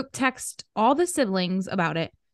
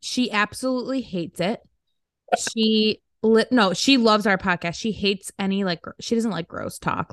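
ordinary delivery

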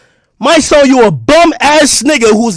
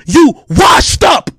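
taunting yell; much slower than usual. Real, not acted. Taunting a rival rapper.

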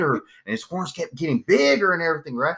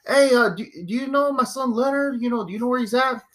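dad retelling; 7 semitones higher than usual. Retold or imitated. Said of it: "It's like".

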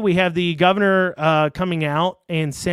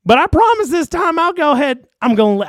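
high, loud, whiny mock promise; mocking Beshear voice; 8 semitones higher, 5 dB louder than usual. Host parodies the governor promising to lift mandates, high whiny mock. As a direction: play the governor pleading with voters. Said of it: "I'm doing this voice for Beshear".

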